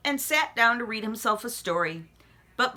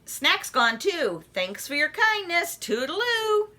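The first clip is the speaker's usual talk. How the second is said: high chirpy frog goodbye; 8 semitones higher than usual. Acted. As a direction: sing out a breezy farewell. Froggy leaving.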